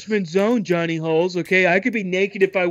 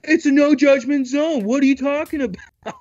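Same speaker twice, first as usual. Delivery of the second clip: indignant; 9 semitones higher than usual. Retold or imitated. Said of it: "And the police come".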